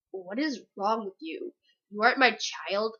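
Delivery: low, quiet mock-mother voice; disapproving mother. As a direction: imitate a mother quietly disowning you. Mother reacting to her bawling.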